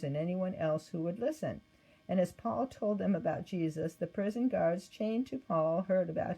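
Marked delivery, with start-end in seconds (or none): none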